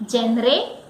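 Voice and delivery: GENRE as JEN-ray, hard J, rising; wrong pronunciation. Shown as a demonstration. Teacher quizzing learners, wrong pronunciation. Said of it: "No, the word is genre".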